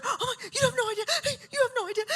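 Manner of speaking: high, gasping mock gush; breathless excitement. Imitating the lady hugging Bree, breathless excitement. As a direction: squeak it out between excited gasps. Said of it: "she's like, she can't breathe".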